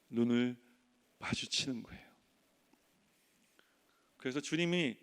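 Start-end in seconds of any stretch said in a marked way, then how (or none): 0.1-2.1 whispered, soft; hushed. Acted. Pastor urging the church to meet the Lord's eyes, whispered. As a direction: breathe it out.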